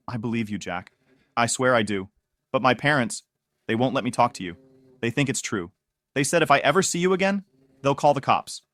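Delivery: plain reading voice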